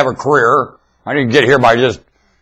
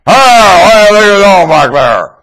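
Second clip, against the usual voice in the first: startled bus driver; 5 semitones higher, 5 dB louder, slower than usual. Retold or imitated. Bus driver reacting to singing.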